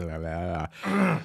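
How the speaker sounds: tongue too numb to talk. Real, not acted. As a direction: mouth burning from hot pepper. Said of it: "my tongue's like".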